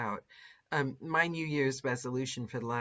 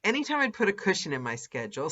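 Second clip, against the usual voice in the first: normal talking voice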